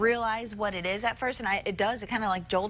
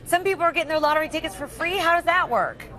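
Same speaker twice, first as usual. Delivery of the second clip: louder, eager and curious; excited; 5 semitones higher, 6 dB louder than usual. Real, not acted. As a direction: perk up, call out to the reporter. Anchor perks up at free tickets.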